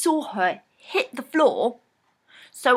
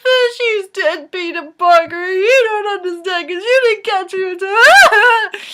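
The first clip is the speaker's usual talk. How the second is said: slow, much higher, louder tearful wail; hammed-up crying plea; 10 semitones higher, 9 dB louder, slower than usual. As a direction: wail at Peter Parker through mock tears. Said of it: "I just kept crying".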